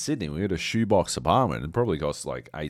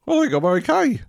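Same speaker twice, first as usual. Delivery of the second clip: much higher, chirpy mock relief; much higher, louder voice of sudden relief; mock recovered; 8 dB louder than usual. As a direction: chirp it like all is well. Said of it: "Saturday I was like".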